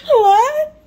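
high loud wailing cry-laugh; mock wail. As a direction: act out a shrieking meltdown, half laughing. Imitating daughter's meltdown reaction.

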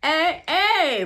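high, loud gleeful cry. Acted. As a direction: crow with glee like a man slapping his thighs. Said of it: "Francois cried, slapping his thighs gleefully".

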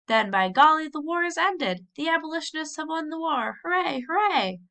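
triumphant hoorays; jubilant cheering. Acted. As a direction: shout it like the best news of your life. Man celebrates war's end.

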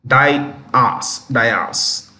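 "Dias" said as DYE-as; incorrect pronunciation. Shown as a demonstration. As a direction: demonstrate "Dias" in two separated syllables. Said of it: "This is incorrect".

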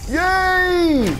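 long high delighted yay; excited cheer. Real, not acted. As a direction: cheer it out, stretched. Cheering after a win.

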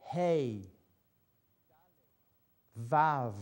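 each letter name alone, slow and clear, with long gaps; teacher's repeat-after-me letter drill; slow pronunciation drill. Shown as a demonstration. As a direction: say each letter firmly so students can repeat it. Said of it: "I want to pronounce it slowly, and you can repeat after me".

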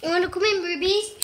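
high, loud sing-song call to the dog. Real, not acted. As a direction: call her in brightly from across the room. Calling the dog inside.

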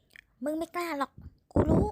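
loud, slow sneering challenge; angry man mocks a threat; scornful taunt. A narrator's reading. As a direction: dare her, loud and scornful.

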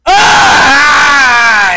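loud anguished scream; frustrated scream. Real, not acted. Gamer screaming before scolding himself. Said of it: "stop screaming, [name]".

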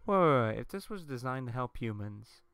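normal talking voice

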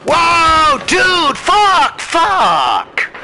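loud startled yelling; shouting. Real, not acted. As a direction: scream it in panic. Player shot suddenly in game.

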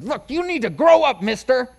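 stern finger-wagging reprimand; scolding. Retold or imitated. Pastor scolding his whining three-year-old son, loud and stern.

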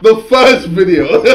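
laughing. Real, not acted. Laughing at the clip.

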